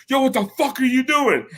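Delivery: high-pitched mock outrage; indignant confrontation, imitated; retold outraged challenge. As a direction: act out the angry friend, pitch way up without yelling. Girl's friend confronts him, retold.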